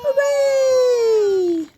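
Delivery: high loud cheer; big celebratory hooray. Real, not acted. Parent cheering the child's reading.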